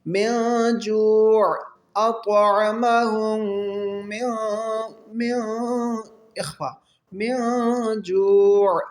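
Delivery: slow, drawn-out tajweed chant; تلاوت کا انداز; Quran recitation. Shown as a demonstration. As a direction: stretch the long vowels like a reciter. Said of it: "stretch کر کے لمبا کر کے".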